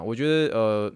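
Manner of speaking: ordinary talk, nothing marked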